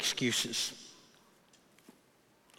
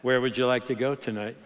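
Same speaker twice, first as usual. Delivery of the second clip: low, lightly seductive ask; mock-sultry date invitation; romantic sultry voice; 6 semitones lower than usual. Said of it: "in a romantic, sultry voice".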